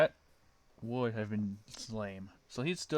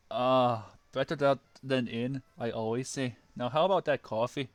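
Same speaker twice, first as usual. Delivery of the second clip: louder, hearty ogre voice; Shrek voice; 8 dB louder than usual. Acted. Shrek to Shadow in bed, pleased with himself. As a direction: boom it out satisfied after a fart. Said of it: "said Shrek".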